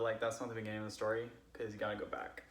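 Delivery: ordinary talk, nothing marked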